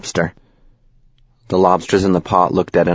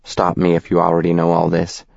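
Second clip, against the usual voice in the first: ordinary delivery, nothing marked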